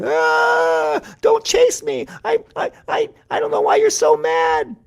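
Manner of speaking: shrieking, very high stammered terror; scared mouse. A narrator's reading. Mouse fleeing from furious Cat, screaming squeaky fear. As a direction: squeal for your life, loud and high. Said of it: "Mouse was so scared".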